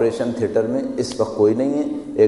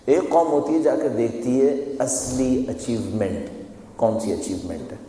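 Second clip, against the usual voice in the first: ordinary talk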